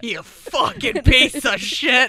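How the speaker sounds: loud, slow mock rage; enraged father's voicemail. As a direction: drag out the insult at full volume. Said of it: "That voicemail".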